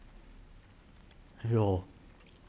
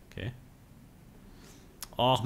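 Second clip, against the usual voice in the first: ordinary delivery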